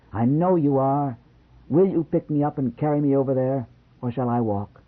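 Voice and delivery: playful teasing wife. A narrator's reading. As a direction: coax him like a flirty wife.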